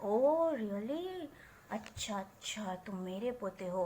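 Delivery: slow, then soft teasing; a grandpa teasing his grandson with fake surprise; mock disbelief. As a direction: tease him with fake surprise.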